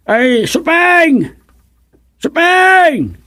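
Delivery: high, drawn-out call across the yard. Acted. Father calling Supeng from afar, long high call.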